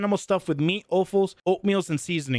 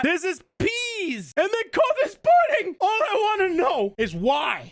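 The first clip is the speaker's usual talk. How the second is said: exasperated rant; 11 semitones higher than usual. Pretend. Streamer ranting to viewers about pudding's name, exasperated. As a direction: demand an answer, voice pitched way up.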